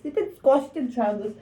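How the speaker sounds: squashed said high and whiny; high playful lament; high mock-whiny voice. Real, not acted.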